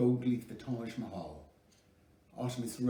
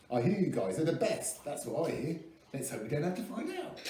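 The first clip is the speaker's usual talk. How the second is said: New Zealand drawl; 6 semitones higher than usual. A narrator's reading. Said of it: "in a New Zealand drawl".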